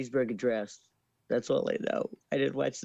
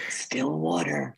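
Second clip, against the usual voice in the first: whisper; slower than usual. Shown as a demonstration. Guest whispering a guess during the whisper segment. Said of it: "does it have to be in a whisper".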